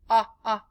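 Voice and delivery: ah repeated as the short o vowel; teacher sounding ah for Oscar Orange. Shown as a demonstration. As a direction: isolate the o of plot. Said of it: "Oscar Orange".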